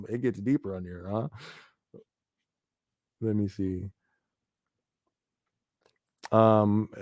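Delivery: normal talking voice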